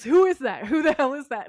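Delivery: loud, alarmed double question; freaked-out loud cry; shocked shout. Retold or imitated. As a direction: yell it at the stranger in the glass. Sam sees stranger's reflection.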